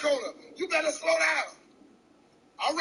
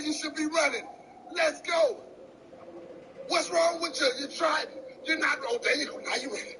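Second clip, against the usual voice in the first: normal talking voice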